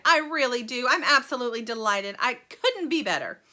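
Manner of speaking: loud, bright showman chatter; excitable Wonka. Acted. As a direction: gush like a thrilled host. Wonka held lively voice.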